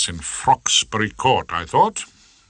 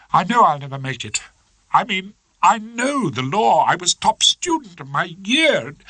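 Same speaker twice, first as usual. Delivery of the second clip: higher, fast upset voice; lightly voiced Miss Trant, distressed; young barrister giving up, upset; doleful young barrister; 10 semitones higher than usual.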